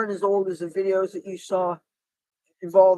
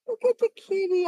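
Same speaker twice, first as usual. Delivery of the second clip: high cooing cat talk; 11 semitones higher than usual. Real, not acted. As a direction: coo at the cat. Calling to a cat.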